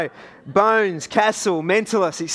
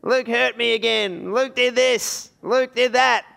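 put-on higher, whiny tattling child's voice; whiny kid brother; 4 semitones higher, slower than usual. Little brothers tattling to Mum, higher whiny child voice. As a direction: whine it like a little brother telling on you. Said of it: "them yelling out".

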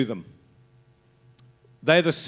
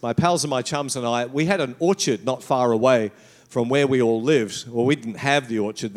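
ordinary delivery

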